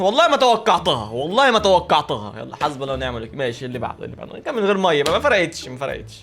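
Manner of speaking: ordinary talk, nothing marked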